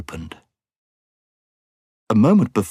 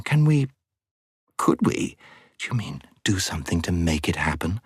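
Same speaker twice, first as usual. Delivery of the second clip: whispering; 3 dB quieter than usual. Acted. Hushed excited whisper.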